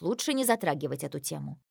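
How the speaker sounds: ordinary delivery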